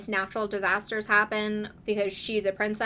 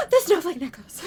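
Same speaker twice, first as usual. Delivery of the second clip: a gasp, then higher and louder; real reader's gasp of dismay; 8 semitones higher, 6 dB louder than usual. Real, not acted. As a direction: gasp at the heartbreak.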